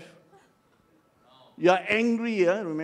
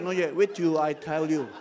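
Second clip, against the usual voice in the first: ordinary talk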